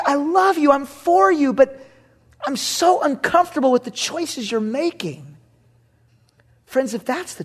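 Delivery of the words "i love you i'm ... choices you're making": higher, pleading disappointment; pained Lord. Retold or imitated. The Lord telling a believer he is uneasy, pleading. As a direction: say it hurt but loving.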